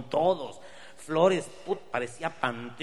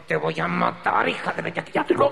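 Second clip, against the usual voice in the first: mock threat; 6 semitones higher, 7 dB louder than usual. Attacker threatening Enedina.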